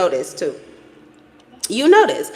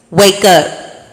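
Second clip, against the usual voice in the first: louder, firm "Wake up"; loud wake-up call; 2 semitones lower, 7 dB louder than usual. Shown as a demonstration. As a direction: shake them awake. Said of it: "I have to say it like that".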